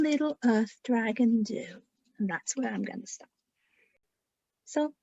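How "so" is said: usual speaking voice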